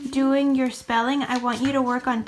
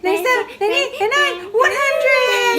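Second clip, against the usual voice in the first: rising, faster and louder to a cheer; turbo race; 6 semitones higher, 9 dB louder, much faster than usual. Pretend. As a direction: race to the finish line building to a shout. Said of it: "Like turbo".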